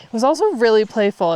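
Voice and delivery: usual speaking voice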